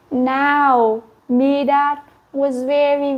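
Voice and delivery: ordinary delivery, nothing marked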